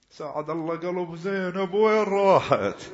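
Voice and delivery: keen for Zainab; mourning lament. Real, not acted.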